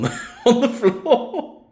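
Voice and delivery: laughing through the words; giggling, high and slowed. Real, not acted. Laughing at dead animal rug.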